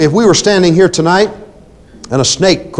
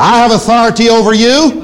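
shouted higher and louder with authority; bold shout; 7 semitones higher, 4 dB louder than usual. Shown as a demonstration. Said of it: "Say it out loud, please, boldly".

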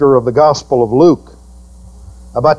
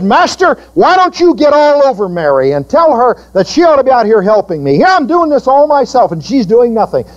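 Martha's complaint; 7 semitones higher than usual. Retold or imitated. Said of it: "Martha in the kitchen got so angry".

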